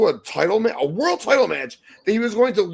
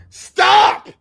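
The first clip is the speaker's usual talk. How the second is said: exasperated shout; 11 dB louder than usual. Real, not acted. Fan ranting about the match card.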